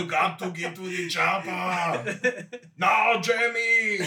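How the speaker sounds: loud, high, slow shouted movie-star voice; Arnold impression. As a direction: shout it like an action hero.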